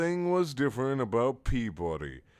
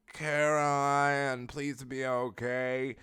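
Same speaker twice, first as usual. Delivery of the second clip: slow, drawn-out mock-tearful begging; crying plea; 5 semitones higher, much slower than usual. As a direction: plead in a put-on weepy voice. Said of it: "Gabe cried to Caroline".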